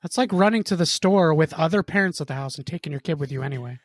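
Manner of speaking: usual speaking voice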